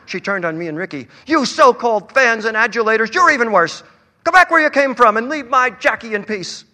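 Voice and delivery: high, loud furious tirade; enraged mother shouting down her son's friends; furious yelling. A narrator's reading. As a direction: drive them out of her house. Mother throwing guests out.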